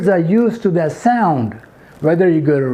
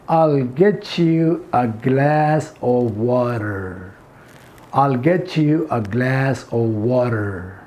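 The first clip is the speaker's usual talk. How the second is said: very slow, low American model; slow American accent; 5 semitones lower, much slower than usual. Shown as a demonstration. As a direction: draw out the American "get-chu" and "water". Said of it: "American accent". (धीमे, खिंचे American लहजे में)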